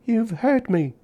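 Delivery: put-on a hurt small child scolding; childish reproach. A narrator's reading. Little girl to the man who dropped her, high reproachful child voice. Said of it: "said a childish voice reproachfully".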